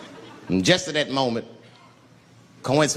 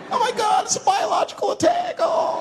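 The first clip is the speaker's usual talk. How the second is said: very high, squealed mock terror; freaked-out panic. Passengers reacting to the man's threat, high panicked shriek. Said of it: "Now everybody freaks out".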